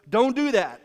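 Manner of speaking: loud, firm "Don't do that"; firm command. Retold or imitated. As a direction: put your foot down as Paul. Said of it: "Paul just ... goes".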